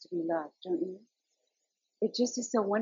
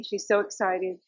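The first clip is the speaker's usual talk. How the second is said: ordinary talk, nothing marked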